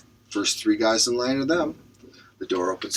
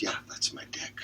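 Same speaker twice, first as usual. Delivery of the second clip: whispered punchline; 13 dB quieter than usual. Acted. Punchline delivered.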